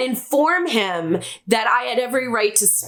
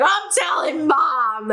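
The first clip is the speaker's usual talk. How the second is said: put-on higher, yelled tattletale threat of a little boy; crying kid threat; 5 semitones higher than usual. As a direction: shout it like a sobbing kid running to mom. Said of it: "He's crying".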